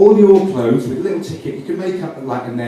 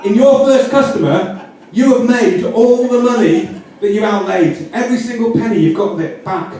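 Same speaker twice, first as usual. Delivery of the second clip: much higher, laughing with glee; giddy, higher pitched, laughing; gleeful excitement; 7 semitones higher than usual. Real, not acted. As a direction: sell the big payoff, delighted. Gleeful payoff of the scheme.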